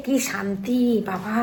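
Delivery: slow, soft sigh of relief; relieved sigh. Real, not acted. Worn out after puja, soft relieved murmur. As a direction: let the relief out slowly.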